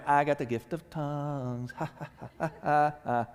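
smug bragging with a gloating laugh; mock boasting. Mocking a boastful church member, smug laugh. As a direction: show off and cackle at the others. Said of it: "the people were boasting".

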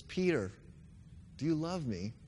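normal talking voice

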